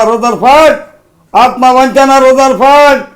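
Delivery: high, loud accusing hero; Krishna as Alluri Sitarama Raju. Acted. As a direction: hurl the charges at the officer. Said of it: "Krishna గారు నటించారు climax సన్నివేశం".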